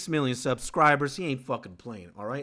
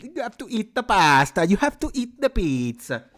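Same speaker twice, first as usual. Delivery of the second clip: high-pitched, hammed-up Italian accent; clichéd Italian accent; 7 semitones higher, 4 dB louder than usual. Shown as a demonstration. Streamer saying cliché food lines, mock Italian accent. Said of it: "gonna say cliche shit".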